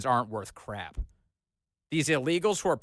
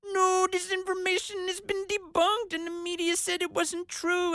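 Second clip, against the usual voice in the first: much higher sneering imitation of officials; mocking high voice. Host mocking the officials' denial in a high squeaky voice. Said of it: "what we're getting is".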